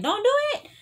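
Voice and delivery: high, loud warning cry; mock viewer plea. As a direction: shriek a warning at the screen. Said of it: "some of y'all are like".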